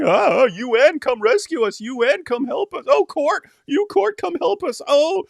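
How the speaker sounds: high-pitched mock whining for help; whiny beggar. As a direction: whine it like a sobbing child begging. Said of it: "endless whining and crying".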